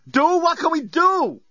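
shout. A narrator's reading. Dan despairing to his friends on the spacecraft, shout. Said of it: "shouted Dan".

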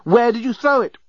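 high-pitched urgent cry; urgent yell. A narrator's reading. Journalist pressing the old genius, urgent high yell. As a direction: yell it high, demanding an answer. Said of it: "yelled the dreadful journalist".

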